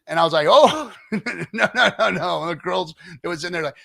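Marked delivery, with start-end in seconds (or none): 0.5-2.4 higher, louder no's broken by laughter, fading out; laughing refusal. Real, not acted. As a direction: laugh it off, no no no trailing away.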